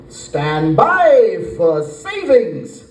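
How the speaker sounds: slow, drawn-out robocall announcer; prank call voice. Retold or imitated. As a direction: stretch it out like an automated sales recording. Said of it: "I just call her up and go".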